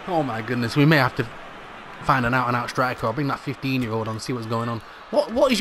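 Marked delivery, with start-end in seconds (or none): none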